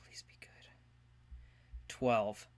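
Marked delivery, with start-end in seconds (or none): 0.0-0.5 hushed hope; whispered plea. Real, not acted. Player whispers to the dice before the roll. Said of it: "whispers to dice".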